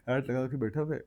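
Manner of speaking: ordinary talk, nothing marked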